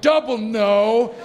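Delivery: loud, slow, stretched-out retort; mock dismay. Big passenger answering seatmates' stares, mock dismay. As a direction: stretch it out loudly for comic effect.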